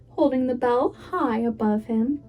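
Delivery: plain reading voice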